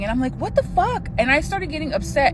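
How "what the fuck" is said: squeaked-up disbelief in a retelling; retold baffled exclamation. Reacting to boyfriend hearing nothing, retold bafflement.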